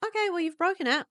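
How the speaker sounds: light, high and unfazed; gentle mum. Retold or imitated. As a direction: chirp it like an unbothered mother. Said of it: "his mum will go".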